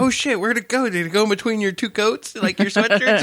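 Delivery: high, urgent questioning; retold alarm. Storyteller asking Bob where the lizard went, high and alarmed. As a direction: relive the frantic search.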